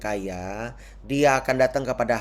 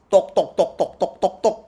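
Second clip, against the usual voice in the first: imitated knocking; 6 semitones higher, 3 dB louder than usual. Widow knocking on the gate.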